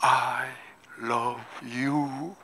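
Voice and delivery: God answering his son softly; tender whisper-soft answer. Acted. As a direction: say it gently as a loving father. God's quiet answer.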